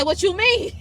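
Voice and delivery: high, loud mock disbelief; incredulous retort. Customer retelling her reply to a cashier, incredulous. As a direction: throw it back as a loud, high question.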